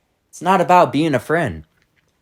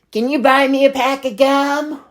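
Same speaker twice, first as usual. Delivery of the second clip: lightly voiced kid, higher; imitating a kid; 7 semitones higher than usual. Strange kid at checkout asking her for gum, lightly voiced child. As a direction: ask like a cheeky little kid.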